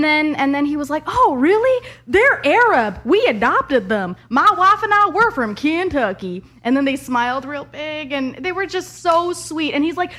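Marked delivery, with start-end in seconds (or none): 1.1-6.4 put-on high, loud, cheery good-old-boy voice. Kentucky dad explaining his adopted kids, loud cheery drawl. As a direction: play the friendly Kentucky dad, hammed up. Voicing the redneck guy.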